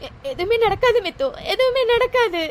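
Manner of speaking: tearful, breaking voice; crying. Acted. Girl crying to her friend over the breakup, tearful. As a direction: let the tears break through the words.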